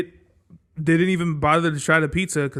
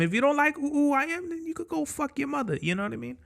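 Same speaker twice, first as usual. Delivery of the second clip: much higher, softer taunt; 10 semitones higher, 6 dB quieter, faster than usual. Real, not acted. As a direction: taunt them up high, fading. High, fading, taunting.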